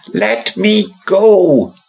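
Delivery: very high, slow eerie doll voice; a living doll pleading, high and slow; creepy doll voice. Acted. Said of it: "said the doll".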